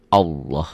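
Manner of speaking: "Allah" with a full, rounded "lo" ending; thick tafkhim Allah. Shown as a demonstration. Teacher demonstrating thick Allah to parents. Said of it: "lafaz Allah dibaca tafkhim, tebal".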